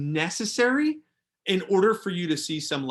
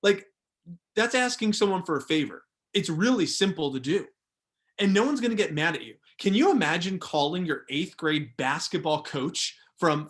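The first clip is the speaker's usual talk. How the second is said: usual speaking voice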